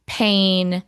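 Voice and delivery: ordinary delivery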